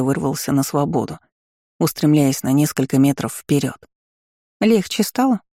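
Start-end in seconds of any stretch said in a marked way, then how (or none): 4.6-5.4 high-pitched, mildly taunting question. A narrator's reading. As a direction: tease with a lifted pitch. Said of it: "Продолжал издеваться надо мной голос". (издевательски, повыше)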